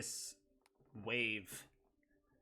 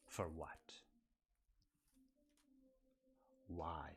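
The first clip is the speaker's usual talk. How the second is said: whisper; 3 semitones lower, 5 dB quieter, slower than usual. Acted. Villain whispers his lament.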